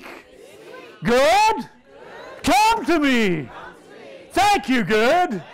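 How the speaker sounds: preacher shouting at good to come; shouted command. Shown as a demonstration. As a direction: call good to you with full force. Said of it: "Say, Good. Come".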